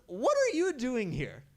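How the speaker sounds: high, loud, slow amused question; laughing tease. Acted. Krishna teasing Shiva in disguise, loud and laughing. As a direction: tease him through laughter. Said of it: "he's laughing and laughing".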